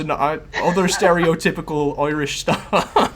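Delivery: a mocking stage-Irish voice, laughing through it; over-the-top Irish accent. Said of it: "the Irish".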